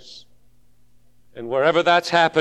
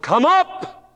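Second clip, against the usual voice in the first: high, loud shout of come up; the Lord shouting to his church; shouted call; 10 semitones higher, 3 dB louder than usual. Acted. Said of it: "he's going to shout".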